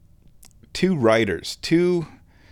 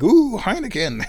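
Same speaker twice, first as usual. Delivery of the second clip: delighted ooh; 11 semitones higher, 4 dB louder than usual. Retold or imitated. Band member excited by beer.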